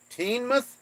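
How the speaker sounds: put-on Teignmouth tried as "TEEN-mouth", high and questioning; trying out Teignmouth. Shown as a demonstration. Viewer asked him to try the town name, so he sounds out Teignmouth. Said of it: "You should try pronouncing".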